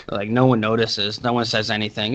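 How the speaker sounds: ordinary talk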